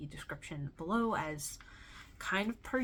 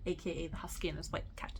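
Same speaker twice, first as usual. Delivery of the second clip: softer, whispered nickname; whispered aside; 4 semitones lower, 7 dB quieter, much faster than usual. Real, not acted. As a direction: slip it in like a secret aside. Aside naming the book's nickname.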